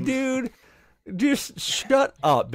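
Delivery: high, drawn-out mock exasperation; exasperated plea. Player telling jinxing friend to stop, exasperated. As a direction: drag out the words, fed up.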